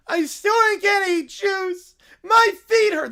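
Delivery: put-on high, loud childish whine; whiny kid. Tired kid whining at the parade, high and loud. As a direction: complain like a tired little kid.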